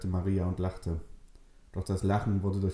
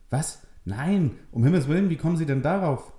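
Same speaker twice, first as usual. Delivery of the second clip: higher, louder, fast flustered protest; shocked denial; 8 semitones higher, 6 dB louder than usual. Acted. Richard denies getting Maria pregnant, shocked and flustered. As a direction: blurt it out, horrified.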